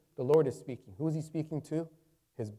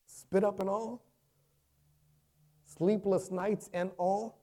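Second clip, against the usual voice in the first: high, rising challenge; incredulous question; 7 semitones higher, slower than usual. Acted. Doubter testing a parent's devotion, incredulous. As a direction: press the doubt, lifting at the end.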